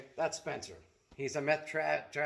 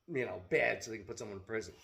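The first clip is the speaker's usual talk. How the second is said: ordinary delivery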